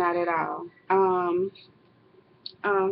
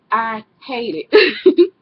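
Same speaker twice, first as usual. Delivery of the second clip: emphatic repeat; 2 semitones higher, 9 dB louder, much slower than usual. Real, not acted. Repeats complaint after laughing.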